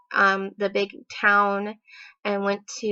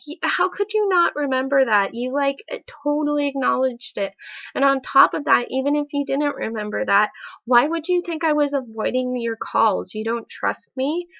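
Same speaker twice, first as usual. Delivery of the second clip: higher-pitched, incredulous retold argument; exasperated challenge; 5 semitones higher, 4 dB louder than usual. Girlfriend challenges boyfriend who forgot, exasperated argument. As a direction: press the point, exasperated and disbelieving.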